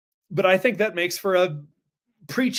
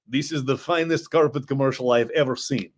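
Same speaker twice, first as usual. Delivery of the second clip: lightly voiced Russian accent; a hint of a thick Russian accent; 2 semitones lower than usual. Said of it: "in a thick Russian accent".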